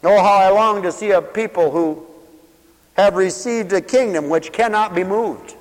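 loud, high-pitched pulpit proclamation; full-force preaching. Real, not acted.

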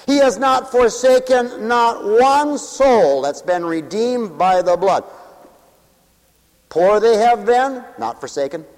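preaching. Acted. Preacher lists sins, affirms mercy.

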